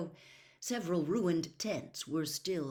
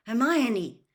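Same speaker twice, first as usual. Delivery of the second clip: sharp raised call of her name; urgent call; 7 semitones higher, 9 dB louder than usual. A narrator's reading. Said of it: "more urgently".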